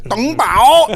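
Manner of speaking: high, loud food-order holler; mock shout. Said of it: "Like, shouted this guy".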